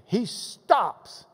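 "stops" drawn apart, slow and emphatic; slow right down and set "stops" on its own; stress on "stops". Shown as a demonstration. Preacher explaining how Jesus halts, slow emphasis on "stops". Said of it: "it emphasizes the word stopped".

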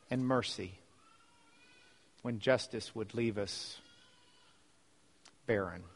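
soft, measured prayer with long pauses; real hushed closing prayer, phrase by phrase. Real, not acted.

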